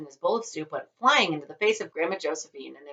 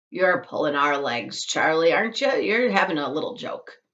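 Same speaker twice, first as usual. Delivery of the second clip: ordinary delivery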